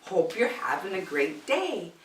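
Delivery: plain reading voice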